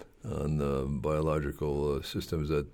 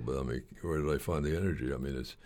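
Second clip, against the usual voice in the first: ordinary talk, nothing marked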